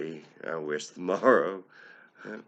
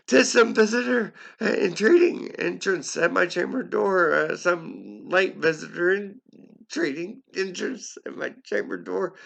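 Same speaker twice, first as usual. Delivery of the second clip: high, loud character voice for the quote; high strained character voice; high put-on voice; 10 semitones higher, 5 dB louder than usual. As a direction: pitch the quoted line way up and push it. Narrator voicing the quote again.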